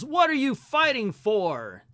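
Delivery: slow, loud hero outburst; angry yell. Acted. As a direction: bark the question in frustration. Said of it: "an angry Superman yells".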